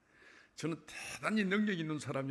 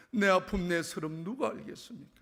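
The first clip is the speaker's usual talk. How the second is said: anguished cry; 7 semitones higher, 9 dB louder than usual. Retold or imitated. Retold tearful prayer.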